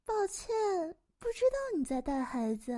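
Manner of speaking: put-on high-pitched old cat lady; high voice, easing down toward the end; talking cat.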